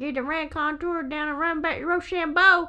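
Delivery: put-on Southern accent; Southern boy. Riffing on the southern boy from Mississippi, Southern accent. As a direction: call it out like a Mississippi country boy. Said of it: "if you're a southern boy".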